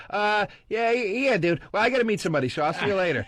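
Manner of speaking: high, hesitant mock brush-off; awkward brush-off. Friend dodging Joe's call, high awkward brush-off. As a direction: squirm out of the call. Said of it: "And you go".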